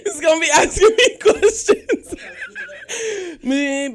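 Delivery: laughing and a bit high; nervous giggle through the words. Real, not acted. Host introduces the co-host, laughing nervously.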